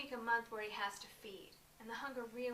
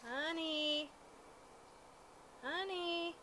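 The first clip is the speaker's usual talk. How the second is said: higher, raised call to a spouse; 6 semitones higher, much slower than usual. Acted. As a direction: call for the husband's attention. Calling to her zombie husband.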